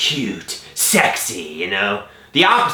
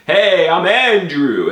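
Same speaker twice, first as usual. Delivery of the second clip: loud, higher, slow New York greeting; New York accent; 7 semitones higher, 5 dB louder, slower than usual. Shown as a demonstration. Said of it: "a man from New York who".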